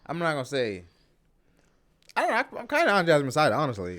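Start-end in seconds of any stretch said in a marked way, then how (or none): 2.1-3.5 sheepish confession. Real, not acted. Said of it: "high fast confession".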